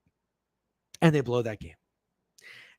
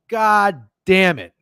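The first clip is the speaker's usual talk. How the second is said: drawn-out angry curse; slow groaning curse at the Niners; slow frustrated groan; 4 semitones higher, 8 dB louder, much slower than usual. Real, not acted. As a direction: drag it out in disgust. Frustrated over lost bets.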